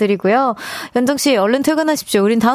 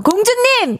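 calling out; 10 semitones higher, slower than usual. Retold or imitated. Mimics husband calling her.

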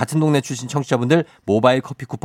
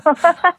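laugh; 4 dB louder than usual. Real, not acted. Host laughs.

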